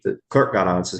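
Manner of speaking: normal talking voice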